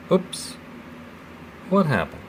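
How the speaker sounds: sudden high startled oops; surprised. Real, not acted. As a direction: caught off guard as it snaps. Lid hinge suddenly breaks.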